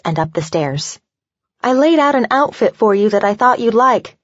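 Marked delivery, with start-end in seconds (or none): 1.6-4.2 higher-pitched, lively call after her; lightly projected, upbeat call; calling after someone. A narrator's reading. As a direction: call it after a friend heading upstairs. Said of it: "Lucy called after her".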